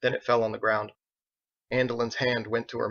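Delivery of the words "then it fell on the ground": ordinary delivery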